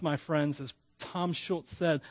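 ordinary talk, nothing marked

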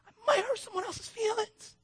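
high, small worried inner voice; inner fearful voice. Retold or imitated. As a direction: voice the fear like a timid child. Voicing his own fearful thoughts.

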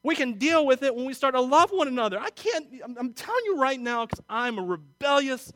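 loud, high pulpit voice; full-force preaching. Acted. Pastor urging the church to love, preaching hard.

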